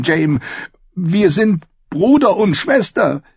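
high, impassioned woman's voice; exasperated sister. A narrator's reading. Sister tells brother they can never marry, anguished. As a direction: scold and lament in a high female voice.

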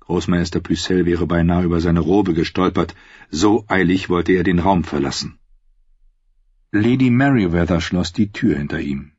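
plain reading voice

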